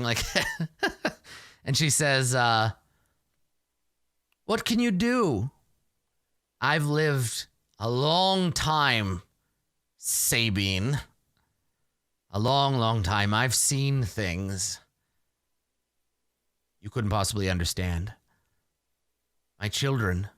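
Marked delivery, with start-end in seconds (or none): 0.3-1.1 high acted laugh, tailing off; laughter winding down. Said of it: "she finishes laughing". 4.4-20.3 slow, weighty village elder, sinking lower; ominous old Granny. Acted.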